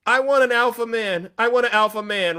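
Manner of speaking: lightly voiced woman demanding an alpha man; mocking conservative women. Mocking conservative women quoted on a live stream. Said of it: "This is how they talk".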